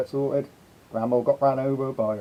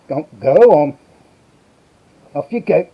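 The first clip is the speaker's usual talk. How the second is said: a loud push to go; loud shoo; 8 dB louder than usual. Real, not acted. Streamer loudly shooing the reindeer out of shot. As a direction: shout him off the set.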